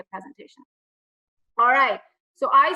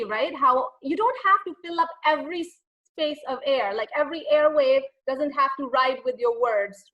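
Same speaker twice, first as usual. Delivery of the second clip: normal talking voice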